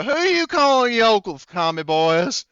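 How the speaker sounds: high, loud, drawn-out holler; yelling out the window. Acted. Mike yelling out the truck window at the Russians, taunting holler. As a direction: holler it like a challenge from a moving truck. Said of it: "yelling out the window".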